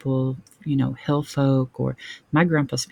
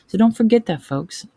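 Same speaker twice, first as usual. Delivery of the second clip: emphatic call out; 7 semitones higher, 5 dB louder than usual. Real, not acted. Urging viewers to remember.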